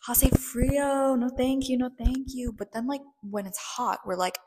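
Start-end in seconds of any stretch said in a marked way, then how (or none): 0.1-2.5 whiny cold complaint. Retold or imitated. Mock shivering complaint about cold.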